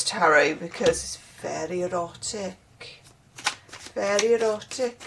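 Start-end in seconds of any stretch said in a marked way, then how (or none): 1.4-2.6 light put-on Welsh lilt; Welsh accent. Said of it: "I'm trying a Welsh accent". 3.9-5.0 playful put-on Welsh lilt; Welsh accent. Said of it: "I'm trying a Welsh accent".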